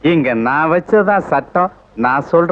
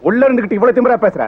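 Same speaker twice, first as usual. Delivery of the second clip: angry threat; 6 semitones higher, faster than usual. Acted. Cop threatens prisoner.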